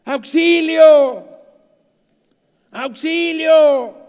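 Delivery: loud, high, slow cry for help. Retold or imitated. Stranded climber screams for help, loud and drawn out. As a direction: stretch out each help. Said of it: "empezó a gritar".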